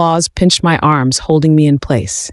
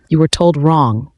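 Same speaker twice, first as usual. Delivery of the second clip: usual speaking voice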